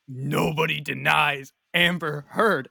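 slow, forceful mock-menacing chant; Amber Heard. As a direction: hammer out each word like a creepy threat. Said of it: "pounding her fist".

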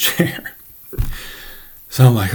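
ordinary talk, nothing marked

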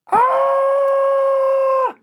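very high, sustained scream; long high shriek of horror; piercing scream. A narrator's reading. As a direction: screech like an owl. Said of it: "scream pitched through the mansion".

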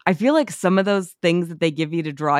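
ordinary delivery

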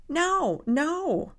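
ordinary talk, nothing marked

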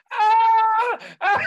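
high, loud frightened yelp; startled cry. Retold or imitated. The startled store worker cries out, a high loud yelp. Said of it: "the guy was like".

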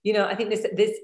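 ordinary talk

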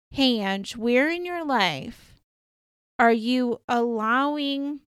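very slow, drawn-out, deliberate delivery; slow pointed questioning. Retold or imitated. Dream challenging the dreamer about her life, slow and pointed. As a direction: stretch each word to make her reflect. Dream's message to herself, spelt out.

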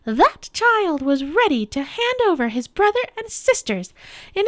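very high, loud witch's tirade; screaming witch. A narrator's reading. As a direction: accuse and demand in a high, forceful witch voice. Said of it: "the witch screamed at Aslan".

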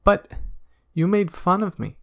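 high, quick rabbit voice. Acted. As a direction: squeak it out fast like a rabbit. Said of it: "And so Rabbit said himself".

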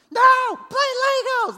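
put-on shrill-high, loud toddler shout, drawn out; child's demand. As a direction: yell it like a stubborn four-year-old wanting his way.

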